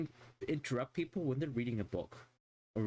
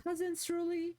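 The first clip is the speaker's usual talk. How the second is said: high, sing-song kid voice mocking them; kids calling out. Streamer mimicking the kids calling her Cousin Surly, high and sing-song. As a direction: mimic the little kids chirping her name. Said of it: "They're like".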